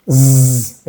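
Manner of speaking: sustained voiced zzz like a mosquito; vibrating Z buzz. Shown as a demonstration. Teacher demonstrates French final Z, vibrating buzz. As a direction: buzz a long Z like a little bee. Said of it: "siempre va a vibrar".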